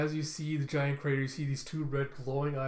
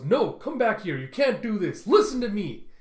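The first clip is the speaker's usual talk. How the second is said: high, loud protest; desperate shout; 7 semitones higher, 9 dB louder than usual. Acted. Prisoner calling after captors walking away, desperate shout.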